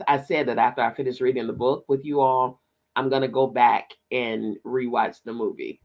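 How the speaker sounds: ordinary talk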